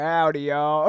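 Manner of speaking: drawn-out Southern greeting; Southern accent. Acted. Guest walking into a biker club, Southern accent. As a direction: swagger in with a Southern hello. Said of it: "I'm only talking in this accent".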